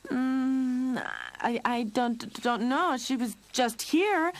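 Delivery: high, slow nervous stammer; stuttering. Acted. Lionel covering for his sister, nervous stutter. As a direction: stumble over the words, caught. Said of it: "Lionel was stuttering".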